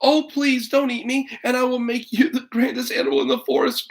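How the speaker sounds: put-on high, hurried begging little girl; Toby begging the bear not to eat her, high and fast; pleading girl. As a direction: beg the bear for your life. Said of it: "cried Toby".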